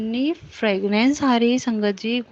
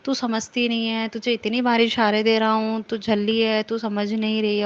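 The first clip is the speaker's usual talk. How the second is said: usual speaking voice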